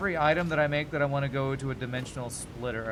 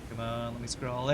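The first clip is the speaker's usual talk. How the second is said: usual speaking voice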